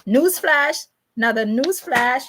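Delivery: high, rising announcer call; high-pitched headline call-out; newsflash announcement. Acted. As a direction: announce it like breaking news. Announces a news item.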